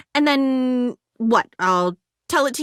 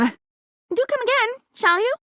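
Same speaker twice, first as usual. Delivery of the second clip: mock polite farewell; 11 semitones higher than usual.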